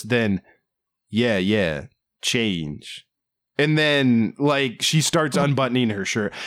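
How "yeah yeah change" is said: flat deadpan. Retold or imitated. Mimicking his reply to her after a long pause, flatly. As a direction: imitate a detached, lower-pitched reply. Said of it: "he completely flatly just goes".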